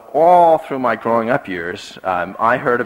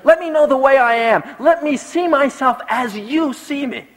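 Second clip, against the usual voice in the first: raised, pleading preacher's prayer; fervent psalm prayer; 14 semitones higher than usual. Acted. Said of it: "we ought pray, as the psalmist prayed".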